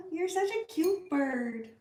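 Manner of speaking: high, gushing coo with a laugh. Real, not acted. Owner breaking off to fuss over her bird, cooing. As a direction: coo adoringly in a high pet voice.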